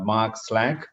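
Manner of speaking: ordinary delivery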